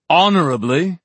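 high, loud questioning echo; incredulous echo. A narrator's reading. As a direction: throw the word back as a challenge. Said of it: "Julian echoed".

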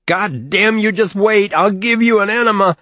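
high, loud, furious scream. A narrator's reading. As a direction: yell it while twisting his arm. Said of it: "he kept screaming".

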